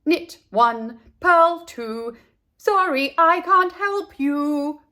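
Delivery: high, loud, slow distracted reply; distracted knitter. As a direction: answer while busy knitting. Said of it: "replied without dropping a stitch".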